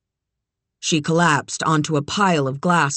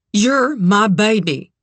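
raised, heartfelt reassurance; higher, emotional declaration; impassioned mother; 4 semitones higher, 4 dB louder than usual. A narrator's reading. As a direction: tell the child with urgent love. Mother claims her frightened child.

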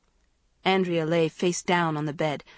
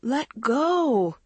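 higher, drawn-out protest; sleepy cry; 7 semitones higher, 3 dB louder than usual. A narrator's reading. Woken sister being shaken, sleepy cry. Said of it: "Andrea cried sleepily".

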